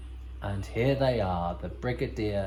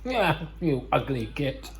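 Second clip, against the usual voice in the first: put-on drunk joker; 4 semitones higher, slower than usual. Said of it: "So Mordred's drunk and laughing".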